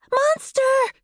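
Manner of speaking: high loud alarmed cry; cried out. A narrator's reading. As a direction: shout it in alarm, pitched high.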